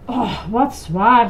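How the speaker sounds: slow, lightly acted sigh of effort. A narrator's reading. Grover lugging a heavy chest, sighing. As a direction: heave a weary, drawn-out sigh. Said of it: "zuchtte Grover".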